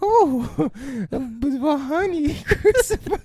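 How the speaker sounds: high, slow sultry bear voice; mock seductive Pooh. Playing Pooh to Christopher, high cooing voice. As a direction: coo at Christopher like a lovesick bear. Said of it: "mock Pooh seducing Christopher".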